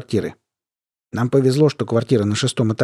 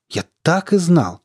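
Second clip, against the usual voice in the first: higher, slower, pressing it home; emphatic repeat; 6 semitones higher, slower than usual. Acted. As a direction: hit it harder the second time.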